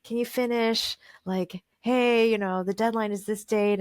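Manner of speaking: lightly voiced pestering about the deadline; nagging editor. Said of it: "he was bothering me about, like".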